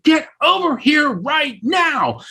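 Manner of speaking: high, drawn-out shouted order; acted-out impatient holler, slow and high; insistent shout. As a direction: call them over slowly, every word stretched high. Repeated, more insistent summons from afar.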